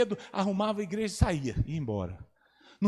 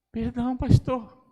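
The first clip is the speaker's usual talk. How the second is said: sheepish apology; 7 semitones higher, 5 dB louder, slower than usual. Retold or imitated. Lady apologizing to pastor.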